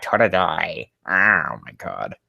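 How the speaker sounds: usual speaking voice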